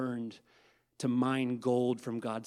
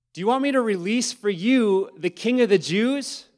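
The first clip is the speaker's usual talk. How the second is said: high, loud, booming question; loud dramatic reading; 9 semitones higher, 11 dB louder than usual. Acted. Pilate calling out to the crowd, loud and high.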